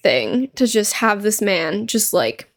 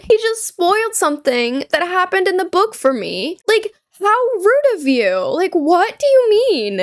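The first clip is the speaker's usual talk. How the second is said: mock outrage; 8 semitones higher than usual. Retelling her outrage at spoiler.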